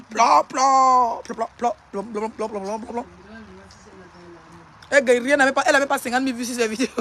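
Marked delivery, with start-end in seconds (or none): 0.1-3.1 "plan plan" burst, starting strong then fading; imitated rapid-fire noise.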